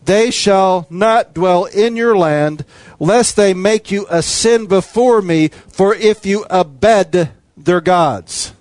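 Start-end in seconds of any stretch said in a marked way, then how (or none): none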